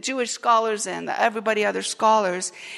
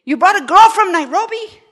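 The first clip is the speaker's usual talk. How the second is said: high, loud outraged cry; a shocked mother-in-law, pitched up and loud; outraged relative; 8 semitones higher, 10 dB louder than usual. Retold or imitated. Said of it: "We kind of go".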